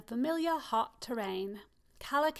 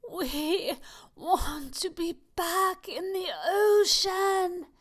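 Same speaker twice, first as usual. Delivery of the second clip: slow, higher, louder breathless plea; gasping; 6 semitones higher, 7 dB louder, slower than usual. Acted. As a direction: fight for breath as you beg.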